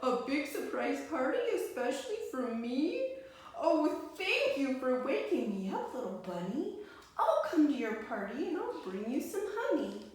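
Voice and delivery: overjoyed at the surprise; happy grinning bear; delighted bear. Acted. Happy bear at his party.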